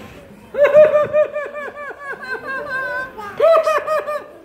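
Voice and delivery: high, loud mock wailing; imitated crying. Storyteller imitating the crying heard on the road, high mock wail. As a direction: wail in long, high pretend sobs. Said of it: "sounded like somebody was crying".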